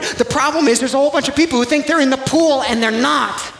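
high, loud, pounding preacher shout; fiery preaching. Acted. Pastor to congregation, shouting that this is only the shallow end. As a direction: drive it home to the congregation at full force.

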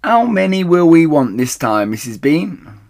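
loud, high call upstairs; shouting up the stairs. Acted. The huge woman shouts up to Missus Bean, loud and high. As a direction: call up to the top of the steps. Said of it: "the woman shouted".

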